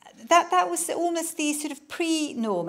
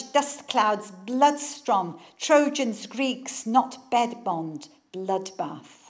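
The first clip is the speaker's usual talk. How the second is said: slow, hammered battle verse; dramatic poetry reading; 2 semitones lower, 6 dB louder, much slower than usual. Acted. Author reading Tony Harrison's war verse, slow and forceful. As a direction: strike each word like a blow. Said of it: "But now listen to this".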